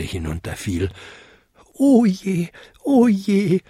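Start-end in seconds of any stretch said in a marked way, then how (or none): 1.7-3.6 high, plaintive little lament; wailing. A narrator's reading. Kobold lamenting his fall to earth, wailing. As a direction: wail it in a small high voice. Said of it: "jammerte er".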